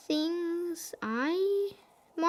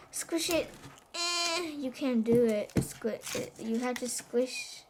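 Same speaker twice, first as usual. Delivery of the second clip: ordinary talk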